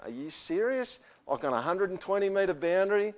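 high, disbelieving "are you serious"; mock disbelief at an absurd idea; incredulous retort. Retorting to neighbours' Colorbond demand.

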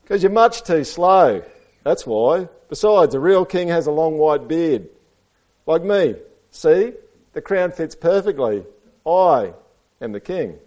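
high, loud read-aloud character voice; self-satisfied storybook goat; boastful goat. A narrator's reading. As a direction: lay it out for the kids, showing off. Reads Goat's speech to children.

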